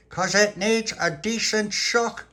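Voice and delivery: put-on high, loud, drawn-out baby talk; playful baby talk. Little pappy teasing baby Lucia's cheek, playful baby talk. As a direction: squeal it slowly at a baby. Boy playing with baby's cheek.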